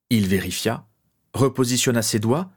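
ordinary delivery

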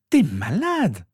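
put-on high, incredulous girl; indignant retort. A narrator's reading. Sister reacting to a trip to Poudlard, incredulous. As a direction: throw it back at him, pitched high. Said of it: "répliqua Rose".

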